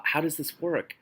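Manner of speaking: ordinary delivery, nothing marked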